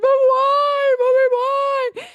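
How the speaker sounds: put-on high, wailing child crying out why; whiny crying little girl. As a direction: cry it out like a hurt little girl begging mommy. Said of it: "she'd be like".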